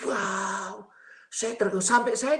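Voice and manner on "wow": drawn-out, higher-pitched wow of wonder; awed wow. Retold or imitated. Preacher reliving a vision of space, awestruck. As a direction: marvel at the sight. Awestruck reaction to what was seen.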